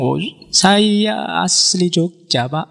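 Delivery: slow Javanese phrase with a Madurese lilt; a Madurese man trying to pass as Javanese; Madurese accent. Retold or imitated. Madurese man insisting to the teacher he's from Jogja, Madurese accent. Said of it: "tapi intonasinya Madura kelihatan".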